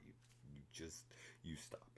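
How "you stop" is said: ordinary talk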